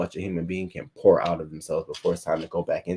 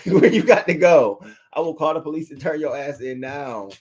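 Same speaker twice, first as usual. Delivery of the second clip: higher, louder, laughing disbelief; mock outrage; 5 semitones higher, 4 dB louder than usual. Wife pretends to confront Idris, laughing in mock outrage. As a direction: playfully demand where he thinks he's going. Imagined reply to husband leaving.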